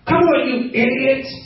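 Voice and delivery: sudden hammed-up insult; mock teacher outburst. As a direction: imitate a teacher berating a class. Teacher insulting kids in imagined class.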